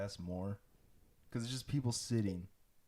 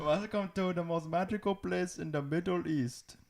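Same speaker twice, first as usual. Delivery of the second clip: high put-on Middle Eastern accent; 8 semitones higher than usual. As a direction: theme park greeting in a Middle Eastern accent. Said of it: "we're doing an Indian voice".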